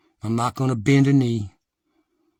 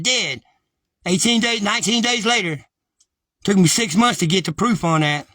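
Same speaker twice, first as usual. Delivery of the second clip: heated agitation; 7 semitones higher, 4 dB louder than usual. Real, not acted. Agitated rant about family deaths.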